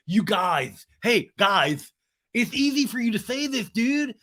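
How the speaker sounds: high, pleading dude imitation; Sandoval. Sandoval interrupting the others, mimicked high protest. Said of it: "And Sandoval's like".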